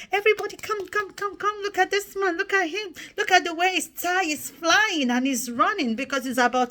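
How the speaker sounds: high, loud call to gather friends; excited calling out. Retold or imitated. Schoolgirl calling classmates to the window, high and loud excited. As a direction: shout for everyone to come look.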